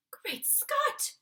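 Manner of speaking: high, loud startled exclamation; the queen cries out in shock; shocked cry. Acted. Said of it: "cried the famous voice".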